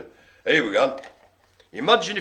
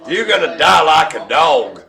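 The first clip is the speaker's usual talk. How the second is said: hammed-up Louisiana threat, half laughing; Spiky Joe, American Southern accent; 8 dB louder than usual. Spiky Joe threatens someone, laughing mock Southern accent. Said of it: "says Spiky Joe".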